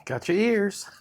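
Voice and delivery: high, playful squeak with a laugh; squeaky playful voice. Pretend. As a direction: tease like with a toddler. Joking game of got your nose.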